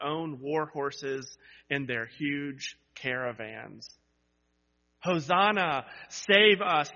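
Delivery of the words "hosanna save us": loud, higher cry to be saved; crowd shout. Acted. Preacher voices the crowd shouting to Jesus, loud. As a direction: call out like the crowd begging. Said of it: "they shouted to Jesus".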